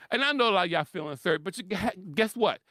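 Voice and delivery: ordinary talk, nothing marked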